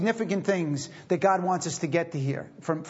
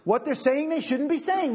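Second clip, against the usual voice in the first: ordinary delivery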